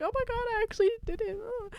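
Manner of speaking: high, soft tearful voice; quick, whimpery mock relief; mock crying. Retold hero after saving MJ, mock crying. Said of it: "It's like crying".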